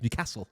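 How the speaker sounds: "Newcastle" with a short, stressed "cassle"; forced pronunciation. Retold or imitated. Mimicking a relative's affected "Newcastle", forced short-a pronunciation. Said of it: "but then he goes".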